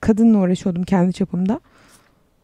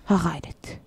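"Ha gayret" diye fısılda, sessizce; fısıltı; 4 semitones lower, 7 dB quieter than usual. Acted. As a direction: kendine gizlice moral verir gibi fısılda. Same cheer repeated in a whisper. (fısıltıyla "Ha gayret")

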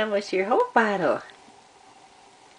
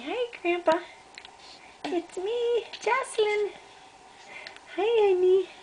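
put-on high baby voice; 8 semitones higher than usual.

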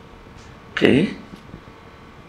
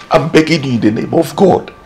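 usual speaking voice

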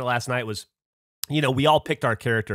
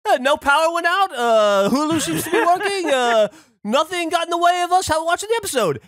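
high, smug mock voice; high mocking impression of a friend; mocking Gavin; 13 semitones higher, 4 dB louder than usual. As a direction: imitate a know-it-all friend jinxing things. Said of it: "and Gavin goes".

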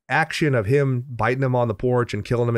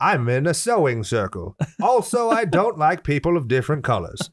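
higher, put-on Southern accent; sweet old lady with a Southern accent; 5 semitones higher than usual. Playing audiobook lady introducing herself to listeners, Southern accent. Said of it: "I go to the South".

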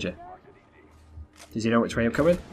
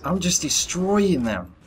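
slow, higher gloating boast; smug brag; 6 semitones higher, slower than usual. Real, not acted. Streamer bragging to viewers about his kills, slow and smug. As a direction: savour every word of the boast.

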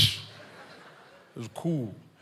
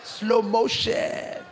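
very slow, higher drawn-out words; slow motion; 7 semitones higher, 4 dB louder, much slower than usual. Acted. Kid performing for guests, very slow high voice. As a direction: stretch every sound like a replay.

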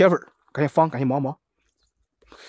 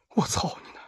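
whispered curse; 9 dB quieter than usual. Real, not acted. Muttered curse.

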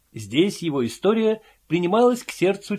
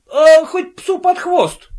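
loud, high, slow rude snap; rude retort; 9 dB louder, slower than usual. A narrator's reading. Invisible man snapping at the envious son, loud and rude. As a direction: fling it back rudely. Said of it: "грубо ответил невидимый".

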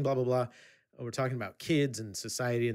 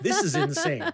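higher, louder laughing disbelief; 6 dB louder than usual. Real, not acted. Amazed at the stranger's behaviour, laughing.

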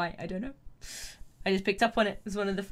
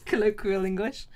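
normal talking voice

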